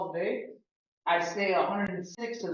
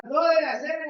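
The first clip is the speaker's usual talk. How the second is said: put-on Cuban accent; 9 semitones higher, 6 dB louder than usual. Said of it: "if they start talking like".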